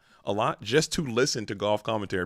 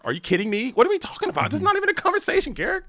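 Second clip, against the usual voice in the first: rising high and fast in disbelief; mock outrage; faster than usual. Real, not acted. Co-caster insists to Garrick, pitch climbing in mock disbelief. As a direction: climb higher with each line, incredulous.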